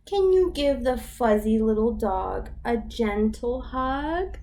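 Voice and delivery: ordinary delivery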